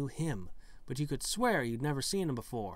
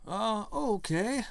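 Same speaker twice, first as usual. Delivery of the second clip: high slow okay; 9 semitones higher, 3 dB louder, slower than usual. Real, not acted. Reacting after the riddle.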